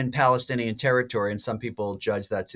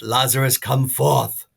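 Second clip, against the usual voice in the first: louder, mock British proclamation; British-accented shout; 2 semitones higher, 6 dB louder, slower than usual. Jesus calling Lazarus from the tomb, imitated British shout. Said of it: "shouts out in a British voice".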